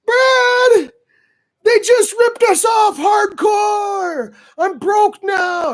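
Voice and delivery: high, slow wailing complaint; a hammed-up tearful buddy; almost crying. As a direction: whine it like he's near tears. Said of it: "almost crying".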